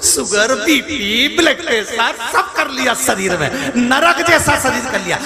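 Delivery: high, driving sermon harangue; impassioned preaching. Acted. Guru scolds hoarding merchant over wrecked body, impassioned preaching. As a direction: hammer each point with rising, scolding force.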